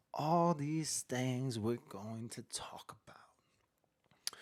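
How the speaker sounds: very slow, drawn-out unvoiced whisper. Host teasing the jail story to the chat, slow whisper. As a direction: stretch each word in a breathy hush. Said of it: "You like my whisper?".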